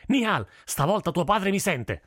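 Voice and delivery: high-pitched angry cry; outraged fruit seller's shout; furious yelling shopkeeper. A narrator's reading. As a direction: scold the running kids, beside yourself. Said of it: "urlò il fruttivendolo fuori di sé".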